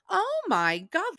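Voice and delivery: high, drawn-out mock alarm. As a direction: act the alarm, pitch leaps up and stretches slow. Retold panic at IV news.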